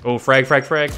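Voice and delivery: loud urgent call of frag; grenade warning yell. Real, not acted. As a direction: yell the warning fast to the team. Grenade thrown during firefight.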